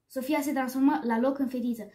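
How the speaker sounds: ordinary delivery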